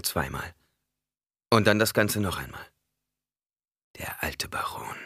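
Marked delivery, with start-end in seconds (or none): 4.0-5.0 softer, hushed revelation; ominous whisper. A narrator's reading. Narrator reveals the enemy in a hushed whisper. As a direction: breathe the dreaded name.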